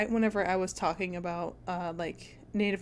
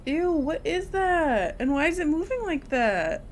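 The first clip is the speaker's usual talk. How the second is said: disgusted; 6 semitones higher than usual. Real, not acted. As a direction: draw out the disgust. Disgusted at creepy thing.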